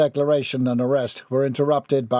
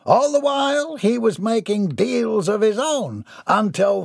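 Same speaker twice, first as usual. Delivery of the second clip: grand, puffed-up villain speech, higher voice; a self-important tycoon addressing the crowd; pompous villain; 8 semitones higher than usual. A narrator's reading. Gerard boasting to his adoring fans, pompous.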